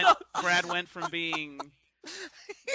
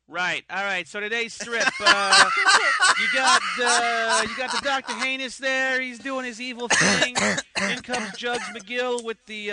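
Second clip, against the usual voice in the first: put-on New York accent; 4 dB louder than usual. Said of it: "It's like".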